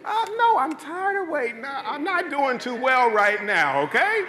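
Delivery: mock-whine about being tired of waiting; exasperated whine. Speaker acting his impatient reply.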